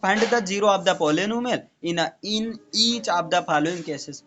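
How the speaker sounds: reads the question slowly, word by word; slow, deliberate question reading. Shown as a demonstration. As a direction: dictate it slowly to the class. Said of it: "teacher reads question aloud".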